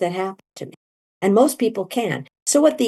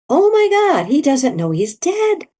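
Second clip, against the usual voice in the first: amazed realisation; 8 semitones higher than usual. Retold or imitated. Seeing her dead friend laughing.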